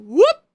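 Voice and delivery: a loud, high mock machine whoop; scanner whoop imitation. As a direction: blurt it like the bag scanner flagging you. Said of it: "that little sucker went".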